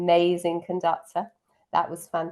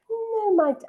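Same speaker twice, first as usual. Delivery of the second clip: attempted Jonty accent. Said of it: "I can't do the accent".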